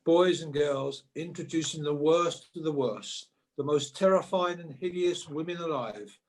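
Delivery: ordinary delivery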